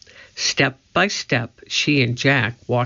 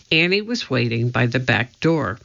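usual speaking voice

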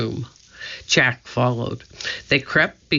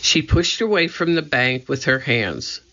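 plain reading voice

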